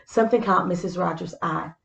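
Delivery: usual speaking voice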